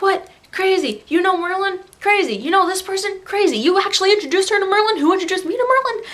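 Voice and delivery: high, rapid-fire disbelief; acted-out amazement. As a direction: rattle off the coincidence in mock astonishment. Acting out amazement at the coincidence.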